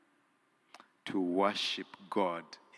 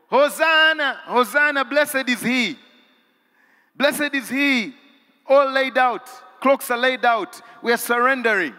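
high, loud chanted praise; full-force preaching; 12 dB louder than usual. Acted. Preacher crying out Palm Sunday praise, high and loud. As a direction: lift it up like a triumphal cry.

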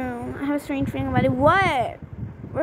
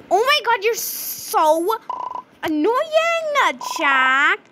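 high, loud exasperated whine; 7 semitones higher, 3 dB louder, slower than usual. Real, not acted. Scolding Jack for losing the key card, exasperated whine. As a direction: draw out annoying like you've had enough.

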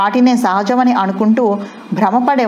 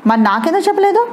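sharp, much higher and fast demand; burning anger; 10 semitones higher, faster than usual. Acted. Husband confronting wife about her hidden past, outraged and rushed. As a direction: fire the question at her in hot anger. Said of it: "ఆవేశంతో దహించుకుపోతూ ఉద్రేకంతో ఊగిపోతూ".

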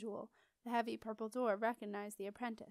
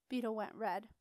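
ordinary delivery, nothing marked

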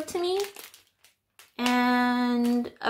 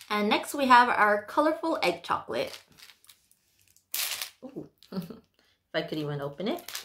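normal talking voice

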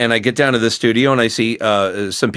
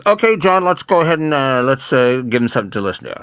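director's voice; 3 semitones higher than usual. Retold or imitated. Director voiced in retold story.

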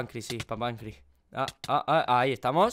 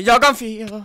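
higher, drawn-up cheerleading call; emphatic encouragement; 5 semitones higher, 4 dB louder, slower than usual. Real, not acted. Gamer rallies struggling friend, raised emphatic voice. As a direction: push the voice up to rally a friend.

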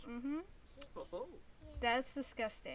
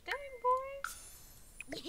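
surprised exclamation; 13 semitones higher than usual. Real, not acted.

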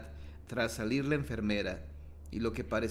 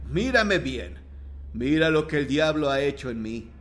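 louder, insistent voice from the grave; ghostly voice from beyond; 2 semitones higher, 7 dB louder than usual. A narrator's reading. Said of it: "Se oye una horrenda voz de ultratumba".

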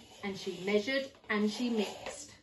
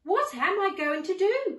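high, loud panicky cry; worried cry; 10 semitones higher, 7 dB louder than usual. Acted. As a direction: cry out in alarm at the river. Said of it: "cried the gingerbread man".

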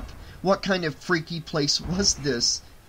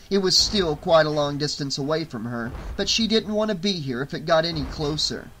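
ordinary delivery, nothing marked

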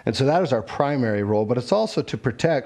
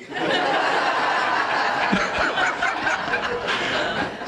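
laugh. Real, not acted. Self-deprecating stutter joke.

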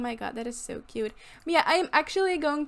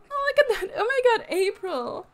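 excited squeal; 7 semitones higher, 4 dB louder than usual. Real, not acted. Cooing at cute festival pets.

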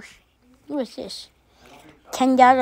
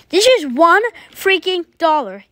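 angry; 6 semitones higher, 5 dB louder than usual. Acted. Angry about money lost.

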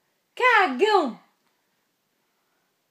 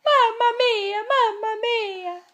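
slow, high sing-song mamamia; drawn-out playful mamma mia; 8 semitones higher, much slower than usual. Shown as a demonstration. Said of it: "Faz o mamamia, então".

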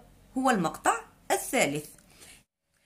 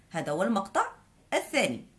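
usual speaking voice

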